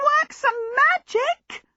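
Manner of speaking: frantic, very high shrill pleading; small djinn shrilling out suggestions, desperate; trumpeting djinn. A narrator's reading. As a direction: trumpet each idea, rising to a final cry. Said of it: "trumpeted the other djinn".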